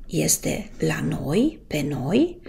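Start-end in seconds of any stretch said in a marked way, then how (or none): none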